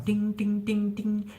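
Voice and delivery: high ding imitation, voice way up; high string sound. Shown as a demonstration. Mimicking the high first string of a guitar. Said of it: "You're playing the guitar".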